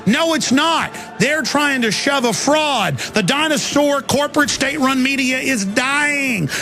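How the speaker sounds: high, fierce, rising rant; rally the listeners, voice up high; fired-up ranting. Real, not acted. Ranting rally against media.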